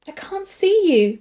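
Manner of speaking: high, loud, frightened cry; fearful call. A narrator's reading. Woman lost in darkness calling back fearfully. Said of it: "she called back fearfully".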